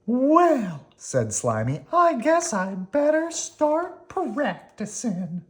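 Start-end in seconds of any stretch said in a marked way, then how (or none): 0.0-0.9 very high, slow, louder worm voice; Slimy weary. Acted. Slimy tells Oscar about the buses, in a very high weary voice. 1.9-5.4 high, slow worm voice; Slimy resigned. Acted. Slimy faces the diving trick, in a high resigned voice.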